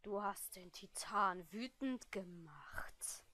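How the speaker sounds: very low, barely audible menace; slow, hushed threat of an angered titan; seething whisper. Acted. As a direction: whisper slowly with building fury. Professor seething before exploding.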